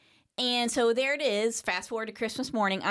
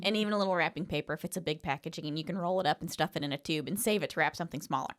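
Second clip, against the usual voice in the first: fast, no breath run-on; one breath; 6 semitones lower, 4 dB quieter, faster than usual. Real, not acted. Daughter racing through wrapping-paper savings, fast. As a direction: rattle it off without stopping. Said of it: "did you take a breath while you were talking".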